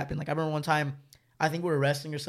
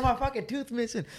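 laughing it off; 9 semitones higher than usual. Retold or imitated. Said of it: "like, laughing".

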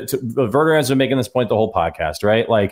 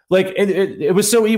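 usual speaking voice

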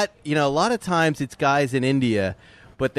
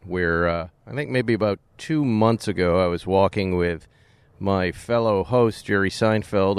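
lower, relaxed host storytelling; podcast intro read; 5 semitones lower than usual. Acted. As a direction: set the scene for listeners like a host. Host's podcast intro segment.

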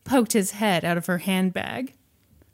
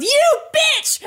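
high, loud mock scream; very high-pitched retold yell; outraged yell; 5 dB louder than usual. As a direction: shriek it like a betrayed boyfriend. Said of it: "and he yelled".

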